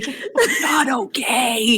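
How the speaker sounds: drawn-out, voiced admission of trouble; slow, strained aside. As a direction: say it slowly and aloud, dropping the reassurance. Breaks from soothing, before mouthing it.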